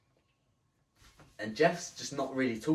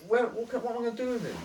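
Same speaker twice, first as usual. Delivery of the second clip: exasperated; 12 semitones higher, 4 dB louder, faster than usual. Retold or imitated. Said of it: "I was like".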